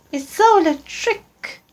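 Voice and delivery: put-on high eager child creature; Gruffalo's child. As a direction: squeak it out like a curious young monster. Child complains.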